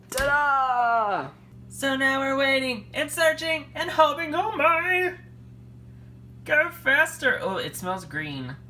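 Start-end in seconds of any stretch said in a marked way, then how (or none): 0.1-5.1 excited. Acted. Excited announcer. 6.4-7.4 excited. Acted. Urging oven.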